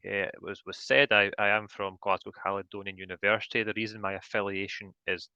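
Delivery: ordinary delivery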